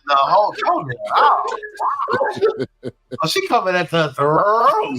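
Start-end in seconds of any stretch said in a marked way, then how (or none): 1.1-3.2 real shouted laugh. Real, not acted. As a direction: whoop at the roast. Reacting to a burn.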